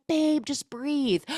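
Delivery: calm him down gently; mock soothing. Mom calming her asthmatic son, slow high soothing.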